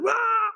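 short high mock scream of a scared passenger; imitated scream. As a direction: yelp it like a terrified flyer. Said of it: "she'd scream".

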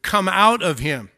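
loud, emphatic order; command. Acted. Jesus commands the demon to leave the man, loud command. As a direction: order it out loudly and firmly. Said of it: "And then the second command".

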